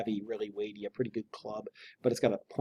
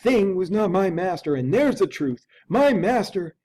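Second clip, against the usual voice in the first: higher, louder butler voice; firm servant's voice, higher; insistent butler; 6 semitones higher, 4 dB louder than usual. Acted. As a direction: press the point as the shaken servant.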